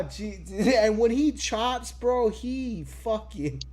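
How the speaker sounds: ordinary talk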